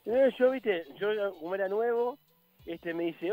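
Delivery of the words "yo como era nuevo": normal talking voice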